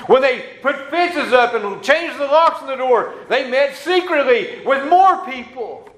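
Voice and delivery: high, fast, half-laughing preacher delivery; fervent preaching. Acted. Pastor telling the church about persecution, excited.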